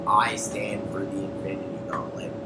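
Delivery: hushed, low whisper; whispered villain line. Retold or imitated. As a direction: breathe it out as a secret boast. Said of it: "And Thanos is like".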